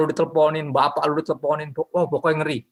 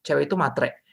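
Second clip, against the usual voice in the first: ordinary talk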